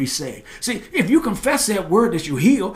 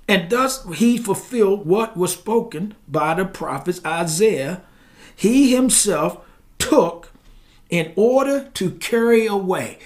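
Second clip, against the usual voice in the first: deliberate reading with punched key words; weighty pulpit reading; emphatic scripture reading; 3 semitones higher, 4 dB louder, slower than usual. Real, not acted. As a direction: read the verse out, leaning hard on each phrase. Reads scripture aloud.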